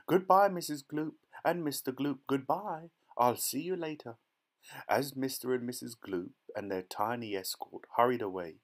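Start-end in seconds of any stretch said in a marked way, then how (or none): 0.1-4.2 high, sing-out farewell; calling out. A narrator's reading. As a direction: call goodbye after a departing couple. Wonka calls goodbye to departing couple.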